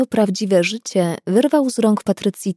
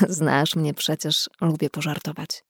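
coaxing; 4 semitones lower, 6 dB quieter than usual. Acted. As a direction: soothe her, half-apologising. Coaxing her after teasing.

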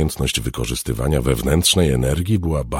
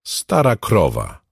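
slow, higher-pitched insult; rude; 7 semitones higher, 4 dB louder, slower than usual. A narrator's reading. Girl insults her older rival, rude. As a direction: snipe like a rude young girl. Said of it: "odparła niegrzecznie Jubi".

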